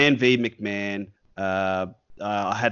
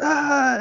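high, louder mock scream of frustration; frustrated yell; 6 dB louder than usual. Clerk failing to find the toy, high mock scream. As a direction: let out a hammed-up high wail.

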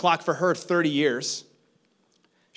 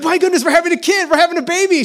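much higher and louder, fast, bursting with joy; overjoyed exclamation; 12 dB louder, faster than usual. Retold or imitated. Husband realizing his wife is pregnant, ecstatic high shout. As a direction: shout the news like you can't contain it. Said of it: "I said".

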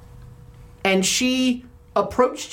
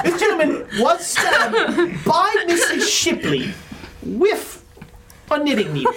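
laughing; 6 semitones higher than usual. Real, not acted. Detective laughing through summary.

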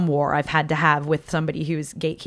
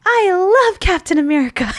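put-on high, adoring child voice; high little-kid declaration of love; imitated little girl; 14 semitones higher, 6 dB louder than usual. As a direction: gush like a small child hugging a hero. Little girl hugging her hero.

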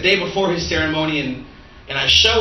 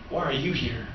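soft and low, letting the question sink in; hushed, solemn question; 5 semitones lower, 9 dB quieter than usual. Acted. Preacher voicing the Holy Spirit's question to the congregation, hushed. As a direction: drop the voice almost to a murmur. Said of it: "I felt the Holy Spirit say".

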